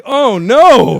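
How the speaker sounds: big, high, loud cry of dread; hammed-up panicked exclamation; acted dismay. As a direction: blow up the moment of horrified realization. Realizing his swearing went out live.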